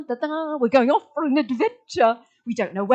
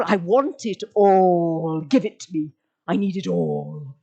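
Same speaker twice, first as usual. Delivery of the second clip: crave it, lower and lower; greedy wanting; 6 semitones lower, 4 dB louder than usual. Pretend. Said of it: "It makes you feel".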